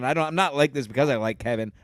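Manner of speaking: ordinary delivery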